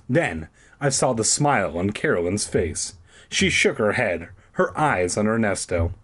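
ordinary delivery, nothing marked

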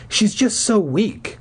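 high, slow and worn out; a mother sighing out bad news; weary mother. Mother explaining on the phone, high and slow. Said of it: "she replied".

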